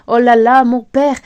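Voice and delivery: loud outburst in a recited poem; sudden burst of recited indignation; furious cry. Acted. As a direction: exclaim it loudly, mock-furious. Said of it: "criera furieux".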